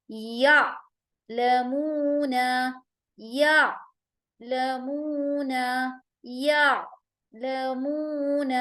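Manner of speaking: یا لمون with a gentle ain, no jolt; smooth ain sound; soft mid-throat ain. Shown as a demonstration. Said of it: "آواز میں نرمی ہوگی اور ہم اس کو جھٹکا نہیں دیں گے".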